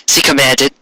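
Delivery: ordinary delivery, nothing marked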